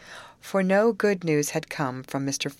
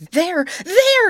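high, loud acted scream of alarm; 10 dB louder than usual. A narrator's reading. Grace spots a live alligator from the boat, screaming. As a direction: cry out shrilly in panic. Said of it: "screamed Grace".

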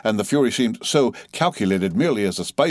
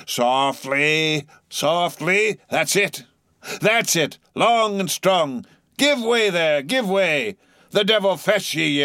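high, jaunty coaxing harangue; Stubb exhorting; 9 semitones higher than usual. A narrator's reading. Mate harangues his rowers, high and jaunty. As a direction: cajole and tease the rowers along.

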